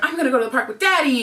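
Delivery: put-on fast, high, thrilled child's voice; excited kid. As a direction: gush like a kid about the park. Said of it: "so he's super excited".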